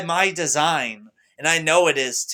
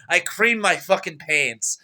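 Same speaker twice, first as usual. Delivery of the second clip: ordinary talk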